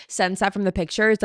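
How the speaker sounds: ordinary talk, nothing marked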